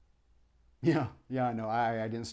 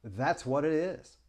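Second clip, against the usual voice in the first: usual speaking voice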